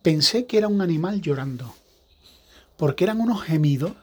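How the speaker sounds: ordinary delivery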